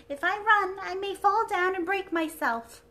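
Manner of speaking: high, loud dainty princess; a delicate china princess, high and bright; sweet airy princess voice. Acted.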